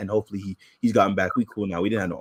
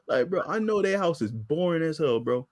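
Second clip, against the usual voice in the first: ordinary talk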